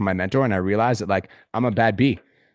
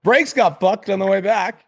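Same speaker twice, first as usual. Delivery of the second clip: high, loud, slow mock-cheery post voice; Kirsten Mark style; 9 semitones higher, 6 dB louder, slower than usual. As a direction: ham up a breezy influencer update. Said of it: "a Kirsten Mark style fucking post".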